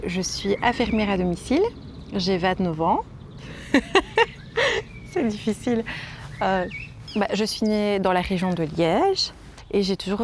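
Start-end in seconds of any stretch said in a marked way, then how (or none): 3.1-5.1 high airy embarrassed giggle; breathy giggle. Real, not acted. Guest laughing after giving her age to the host, airy giggle. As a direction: laugh it off, a little shy.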